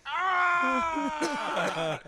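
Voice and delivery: loud high wordless yell; just let out a sharp shout. Shown as a demonstration. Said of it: "you just yell like".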